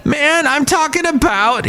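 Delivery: high mocking voice of the joker; mock excuse. Friend backpedalling after a bad joke, mock high voice. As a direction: squeak it out like a guy backpedalling.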